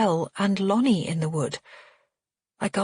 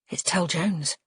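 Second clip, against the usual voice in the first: ordinary delivery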